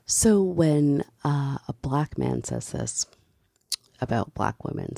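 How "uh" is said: ordinary delivery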